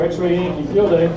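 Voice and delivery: usual speaking voice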